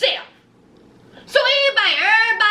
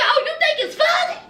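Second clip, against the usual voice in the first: much higher, quick and sneering; mocking high voice; 10 semitones higher, faster than usual. [name] taunting snickering Mama June in a high mocking voice.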